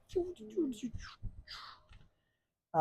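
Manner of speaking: high then unvoiced choo; whispered gun noises. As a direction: whisper little pew sounds.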